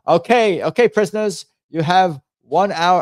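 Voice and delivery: high-pitched mock British prison guard; British accent. Guard announcing streaming hours to prisoners, in a British accent. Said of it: "they talk with, like, a British accent".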